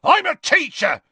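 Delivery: loud, high-pitched roar; furious bellow; angry roar. A narrator's reading. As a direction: roar it in outrage. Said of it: "he roared at Harry".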